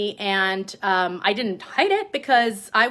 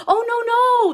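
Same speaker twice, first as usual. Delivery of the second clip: high-pitched, louder mock panic; backpedaling bosses; 14 semitones higher, 5 dB louder, slower than usual. Mocking the bosses as they backtrack on time off, high backpedaling. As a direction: mimic someone hastily backing off, pitched way up. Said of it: "The backpedaling started".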